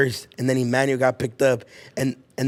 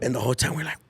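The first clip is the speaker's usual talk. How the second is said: ordinary talk, nothing marked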